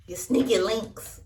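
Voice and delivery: loud punchline. Real, not acted. Punchline, louder reveal.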